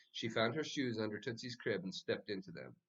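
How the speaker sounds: plain reading voice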